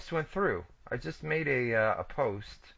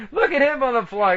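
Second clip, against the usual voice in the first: excited; 10 semitones higher, 5 dB louder than usual. Real, not acted. Repeat, much higher and louder.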